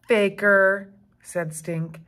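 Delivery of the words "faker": louder teasing jab; taunt. Acted. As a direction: tease your sister. Stink taunts.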